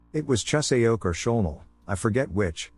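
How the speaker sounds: ordinary delivery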